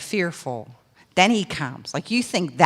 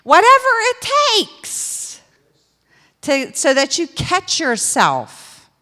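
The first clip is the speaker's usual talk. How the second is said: full-force preaching; 4 semitones higher, 6 dB louder than usual. Real, not acted. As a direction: drive it home to the church.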